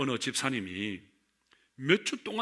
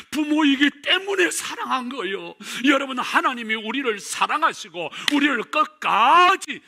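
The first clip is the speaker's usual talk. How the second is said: loud, higher fervent cry; preaching shout; 9 semitones higher, 7 dB louder than usual. Real, not acted. Preacher crying out to the congregation about love. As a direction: drive the point home at full force.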